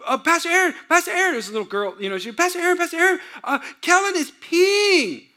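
put-on high, urgent little girl tattling; a lightly voiced little girl running in to tattle. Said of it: "they said".

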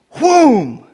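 loud high mock whoosh; playful whoom; whoosh sound effect. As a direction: make the swooping kick noise.

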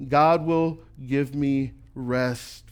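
very slow, deliberate promise; slow, comforting "God will give me rest"; slow reassurance. Acted. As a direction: let each word land slowly. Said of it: "When I feel overwhelmed".

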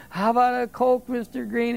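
a hint of a shy kid asking; boy's voice. Boy's line retold in Coke ad.

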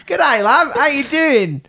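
high-pitched mock Aussie accent; Australian accent. Shown as a demonstration. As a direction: greet them in an exaggerated Australian accent. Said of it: "what would Australian be like".

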